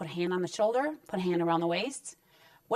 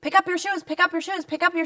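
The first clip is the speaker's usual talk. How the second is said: higher, louder repeated nagging; 8 semitones higher, 3 dB louder than usual. Retold or imitated. Mother nagging her son again and again about his shoes, higher and louder. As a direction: harp on it like a worn-out mom. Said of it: "Avoid nagging with no consequences".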